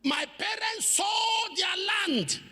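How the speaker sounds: high, slow wailing cry; wailing sister. Retold or imitated. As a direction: wail it like the weeping sister. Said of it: "She was wailing, weeping".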